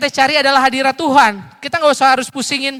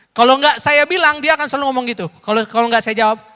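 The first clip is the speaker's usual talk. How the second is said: normal talking voice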